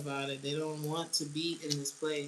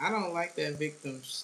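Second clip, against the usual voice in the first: ordinary delivery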